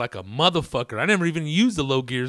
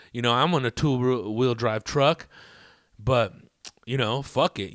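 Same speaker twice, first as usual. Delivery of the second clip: ordinary talk, nothing marked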